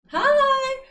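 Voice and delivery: high, loud happy hi; bright greeting. Real, not acted. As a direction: chirp hello at a friend. Greeting a chatter.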